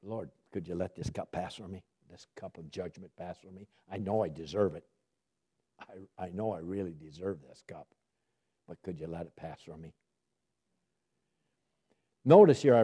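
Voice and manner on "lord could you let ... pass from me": softer and lower, pleading with the Lord; a sinner softly confessing and asking mercy; soft pleading prayer. Retold or imitated. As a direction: beg quietly for mercy. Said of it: "we prayed".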